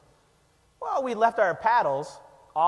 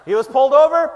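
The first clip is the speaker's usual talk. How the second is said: incredulous exclaim; 8 semitones higher, 11 dB louder than usual. Real, not acted. Laughing disbelief telling story.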